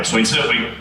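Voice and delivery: usual speaking voice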